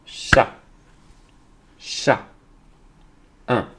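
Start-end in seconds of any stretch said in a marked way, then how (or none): 0.0-2.2 each Chat set apart by silence; syllable beat. Shown as a demonstration. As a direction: Chat as one short, isolated beat. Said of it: "on va frapper les syllabes".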